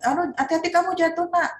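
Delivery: put-on high, anxious parent fussing at a child. Mother warning her small child. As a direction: gasp out a worried warning. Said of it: "Kadang-kadang orang tua yang".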